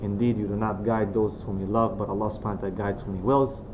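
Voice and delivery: ordinary talk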